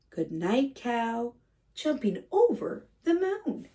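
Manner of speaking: usual speaking voice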